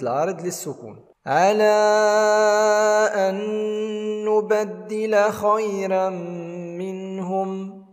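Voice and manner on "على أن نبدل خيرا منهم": slow, chanted tajweed recitation; measured tarteel chant; Quran recitation. Shown as a demonstration. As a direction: recite it melodically, drawing out the madd. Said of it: "هنا على مد ست حركات".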